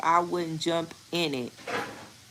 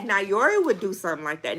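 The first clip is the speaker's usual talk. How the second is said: usual speaking voice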